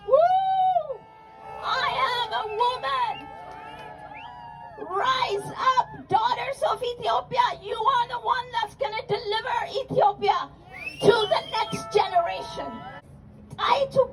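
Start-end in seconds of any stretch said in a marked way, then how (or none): 0.1-1.0 high whooping cheer. Real, not acted. Rally cheer. 4.9-12.7 rallying cry. Real, not acted.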